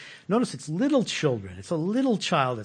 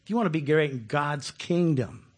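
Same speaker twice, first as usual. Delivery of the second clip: ordinary delivery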